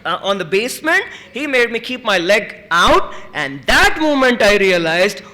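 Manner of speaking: ordinary delivery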